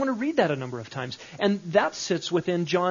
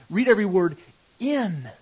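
"in" held long and pitched up high; stress on in; 3 semitones higher, 5 dB louder than usual. Shown as a demonstration. Teacher showing how to emphasize each word, stress on "in". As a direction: punch "in" after a brief pause. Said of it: "read by emphasizing every word".

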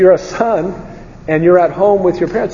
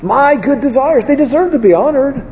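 raised high, insisting on it; higher, pushing pitch of entitlement; entitled insistence; 9 semitones higher than usual. Shown as a demonstration. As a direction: climb up into self-righteous demand. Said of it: "hear yourself tell yourself".

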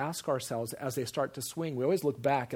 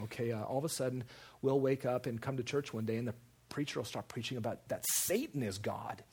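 ordinary delivery

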